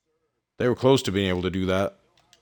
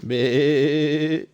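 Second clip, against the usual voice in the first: long, higher imitated goat bleat; 5 semitones higher than usual. Player jokes about the GOAT, imitating a goat bleat. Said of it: "that the goat".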